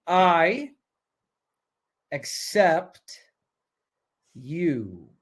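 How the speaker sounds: word by word with long pauses; slow dictation. Shown as a demonstration. Coach giving the group words to write, slow dictation.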